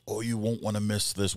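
normal talking voice

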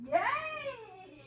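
loud, high, laughing yay; excited cheer. Real, not acted. Dog completes a trick.

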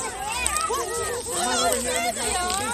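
high, slow sing-song call. Real, not acted. Calling kids over to the grass.

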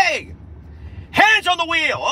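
high, loud mock Mexican accent. Driver ordering hands on wheel, shouted mock Mexican accent. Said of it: "you're not from Mexico".

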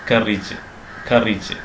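കറിജ് as "KUR-ij"; short "-ij" ending on കറിജ്; correct pronunciation. Shown as a demonstration. Teacher modelling for learners, കറിജ് as "KUR-ij". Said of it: "വായിക്കരുത്".

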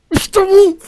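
a high, loud cheer of triumph; excited shout. Real, not acted. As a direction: shout it out like your team just scored. Cheering when Eren succeeds. (yüksek sesle sevinç çığlığı)

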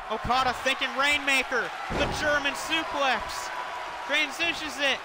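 high, excited play-by-play calling; wrestling commentator. Acted. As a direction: call the big moves hyped up, ringside. Live play-by-play of a wrestling match.

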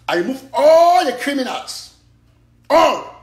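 loud, slow and high, the criminals are gone; ringing slow triumph; triumphant shout. Real, not acted. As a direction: declare it like a victory. Triumphant declaration.

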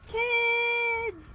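high, loud call across the field. Real, not acted. Player calling the kids over, loud high call.

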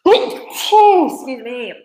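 real loud sneeze running into an apology; sneezing. Real, not acted. Presenter caught in a sneezing fit, sneezing as they apologise. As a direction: sneeze loudly, then excuse yourself. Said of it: "I'm having a little fit now with sneezes".

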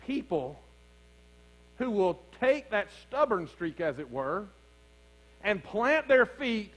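steady preaching, building toward the climax; preacher building his case; sermon delivery. Acted. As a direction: lead the congregation through the story.

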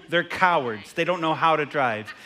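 ordinary delivery

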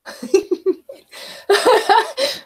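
long rising giggle, high up; real laugh. Real, not acted. Streamer laughing at the next chat comment, rising giggle. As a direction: laughs freely before reading the name.

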